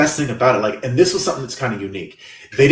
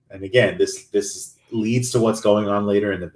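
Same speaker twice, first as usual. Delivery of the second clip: ordinary talk, nothing marked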